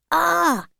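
sudden high cry of shock. A narrator's reading. Twins seeing the fight end, shocked cry. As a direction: react to the garden fork. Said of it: "cried the twins".

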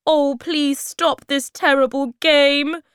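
high, loud desperate plea; crying out. A narrator's reading. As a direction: beg them loudly to stop. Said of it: "cried Moomintroll".

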